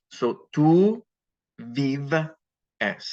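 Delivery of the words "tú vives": tú vives in slow separated pieces; stem, then ending. Shown as a demonstration. Teacher building you live for students, piece by piece. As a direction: pause after tú and before the ending of vives. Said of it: "and I get vive".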